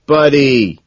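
loud, high exclamation; crying out. A narrator's reading. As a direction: holler it like a hearty greeting. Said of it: "Van Patten cries out".

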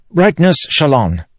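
high-pitched character voice for the king; Elhokar. A narrator's reading.

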